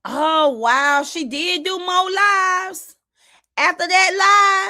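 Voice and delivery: high, slow, stunned; shocked disbelief. Real, not acted. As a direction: drag it out in real shock. Learning of more attacking lives.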